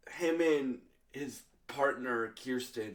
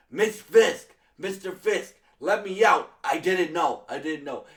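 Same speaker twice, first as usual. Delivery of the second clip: high, loud pleading to be let out; panicked begging; 6 semitones higher, 11 dB louder than usual. Retold or imitated. Adam pleading with Fisk to let him out, high panicked begging. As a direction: beg like a trapped man in terror.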